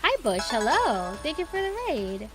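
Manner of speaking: high sweet greeting voice; cute anime streamer. Acted.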